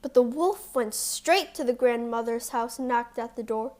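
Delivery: usual speaking voice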